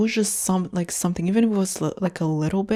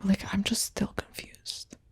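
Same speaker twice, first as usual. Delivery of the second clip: defeated whisper; 9 dB quieter, slower than usual. Real, not acted. Trailing, defeated admission.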